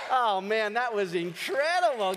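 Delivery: ordinary delivery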